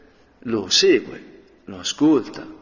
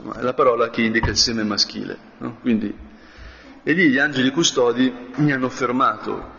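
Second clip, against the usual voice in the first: normal talking voice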